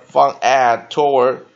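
slow, loud read-aloud; slow model reading. Shown as a demonstration. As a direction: read it slowly, word by word. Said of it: "let's repeat the pronunciation". (慢速示范朗读)

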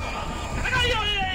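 sudden high shout; startled shout. Real, not acted. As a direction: yell out in fright. Jump scare in game.

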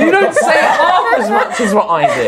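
high, laughing protest; laughing complaint. Real, not acted. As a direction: complain while cracking up.

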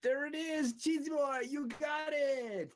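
high, loud, drawn-out cheering; excited cheer. Real, not acted. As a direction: celebrate the new subscriber out loud. Cheering a subscriber.

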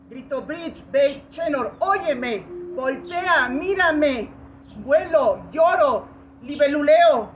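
declaimed climax of a poem; climactic calling out. Acted. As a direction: fling each word out high and loud. Calls out at poem's climax.

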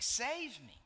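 high, loud imitated child's plea; lightly hammed-up kid begging for rescue; mock cry for help. Repeats son's cry for help.

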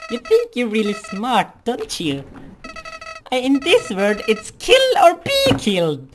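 high, loud mocking villain flower; Flowey voice. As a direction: taunt them, rising to a shriek. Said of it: "my flowery voice".

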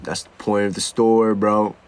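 low, quiet bro explaining the obvious; deep lazy shop owner stating the obvious. Retold or imitated. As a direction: store owner to customer, deep and deadpan. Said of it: "And they're like".

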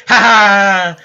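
slow, loud mock laugh; mocking sheep laugh. Gamer imitates the sheep laughing at him, mocking. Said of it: "He's like".

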